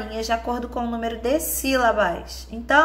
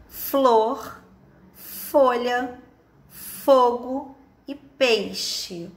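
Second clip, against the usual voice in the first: "Flor, folha, fogo e peixe" slowly, a pause after each; careful word list; much slower than usual. Shown as a demonstration. Teacher naming pictures by first sound, slow and separated.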